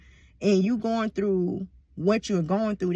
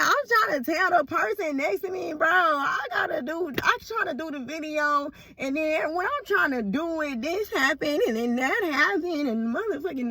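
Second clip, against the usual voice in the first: acted exasperation; 7 semitones higher than usual. Acts out pleading with person beside her.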